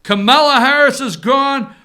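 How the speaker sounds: ordinary delivery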